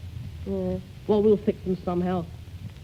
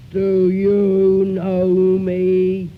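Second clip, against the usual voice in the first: very slow, loud and hollow; unearthly voice; 3 semitones lower, 8 dB louder, much slower than usual. Shown as a demonstration. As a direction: stretch each word out, ghostly and booming. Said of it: "Is that holler enough?".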